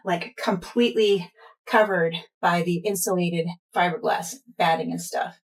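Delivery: usual speaking voice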